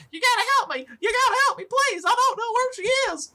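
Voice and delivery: very high, squeaky pleading; agitated gnome. Acted. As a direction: plead frantically as a little older gnome. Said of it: "trying to calm him down".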